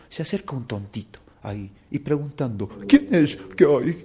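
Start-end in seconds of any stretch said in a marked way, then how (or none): 2.9-3.9 high, loud simpleton voice; pushy simpleton. Acted. Simpleton asking the crowd for permission to pass, high and loud. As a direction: pipe up loudly to get through.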